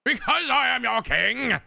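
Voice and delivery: hammed-up royal outburst, high and loud; over-the-top kingly tantrum; loses his cool. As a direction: shout it as a mock-enraged husband pulling rank. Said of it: "He loses his cool and goes".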